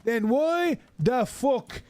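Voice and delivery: high, slow, over-careful English; slow tourist English. Retold or imitated. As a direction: drag each word out for a foreigner. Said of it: "slowing down their English tune".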